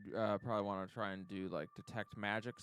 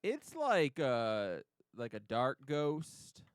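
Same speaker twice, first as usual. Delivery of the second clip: put-on valley girl voice; 4 semitones higher than usual. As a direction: stretch the likes like a bored valley girl. GM keeps palm tree voice.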